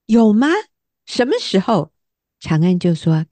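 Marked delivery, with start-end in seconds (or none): 0.1-1.9 startled protest. Retold or imitated. To her husband, caught off guard, surprised. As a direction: ask it in genuine shock. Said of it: "我听了吓一跳".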